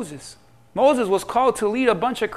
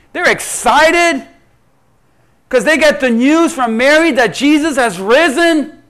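ordinary talk, nothing marked

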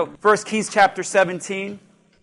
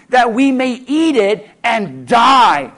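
slow, loud despairing cry; dramatic despair; 3 semitones higher, 6 dB louder, slower than usual. Acted. Widow telling Elijah her last meal, slow and despairing. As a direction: wail the doomed ending.

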